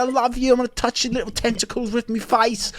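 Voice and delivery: high, loud hammed-up Cockney whine; mock Cockney prince. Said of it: "he's just like".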